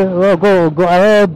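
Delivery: usual speaking voice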